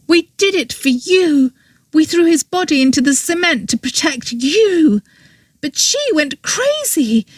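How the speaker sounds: pleading anger. A narrator's reading. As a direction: press it on him, high and intense. Girl explains motive to Oberon.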